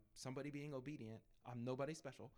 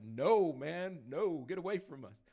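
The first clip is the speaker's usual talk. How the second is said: much louder, forceful retelling of a brush-off; retold angry refusal; 4 semitones higher, 14 dB louder, slower than usual. Boyfriend turning away a stranger offering prayer, angry and loud. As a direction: act out a boyfriend loudly warning someone off.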